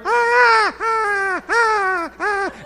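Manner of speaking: a high Ah repeated and fading like an echo; imitated echo. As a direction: mimic the scream bouncing around the hall. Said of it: "you heard".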